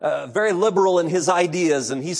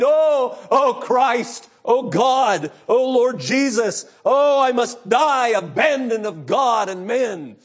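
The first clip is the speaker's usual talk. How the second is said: slow, higher, louder crying plea; desperate plea; 5 semitones higher, 3 dB louder, slower than usual. Retold or imitated. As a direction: beg in terror of death. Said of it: "he cried".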